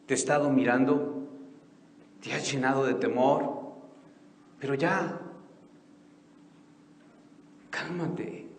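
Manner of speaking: soft, gentle voice of comfort; tender comfort. Acted. Jesus speaking to a frightened believer, soft tender comfort. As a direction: speak like a caring friend close by.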